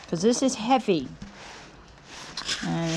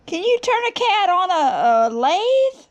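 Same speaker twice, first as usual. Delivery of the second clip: much higher, incredulous rising voice; amazed question; 10 semitones higher, 3 dB louder than usual. Real, not acted. Telling viewers about turning a cat, amazed and high. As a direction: marvel at the crazy idea.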